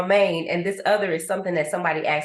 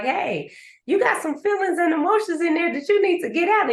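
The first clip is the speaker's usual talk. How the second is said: higher, faster, nudging voice of grief; grief talking; 9 semitones higher than usual. Retold or imitated. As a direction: play grief poking you to let it out. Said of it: "to be like".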